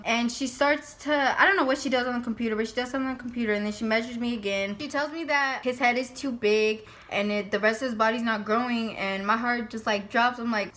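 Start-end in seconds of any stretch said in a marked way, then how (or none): none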